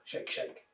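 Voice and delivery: voiceless shaking sound; wolf rattling the house; whispered shake. Acted. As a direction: breathe it as an effect.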